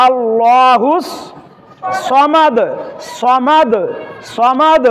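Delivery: drawn আল্লাহুস, then firm সমাদ with heavy 'saw'; thick saad. Shown as a demonstration. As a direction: round the s of সমাদ, bounce the final d. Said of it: "এটা হচ্ছে মোটা হরফ". (সমাদ-এর স মোটা করে)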